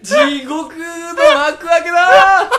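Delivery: high, slow laughing wail; hammed-up despair. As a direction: wail it out, overdone.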